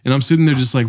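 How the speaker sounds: ordinary talk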